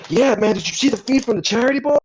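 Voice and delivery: high, psyched-up agent pitching his act; super psyched. Acted. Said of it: "he is super psyched".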